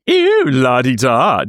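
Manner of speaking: loud, slow, drawn-out jeer; mocking posh teasing. Co-host teasing the star chorister, mock-impressed jeer. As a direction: tease a show-off in a put-on voice. Teases boast of singing perfectly.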